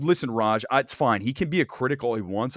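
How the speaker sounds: ordinary talk, nothing marked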